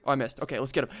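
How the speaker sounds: ordinary delivery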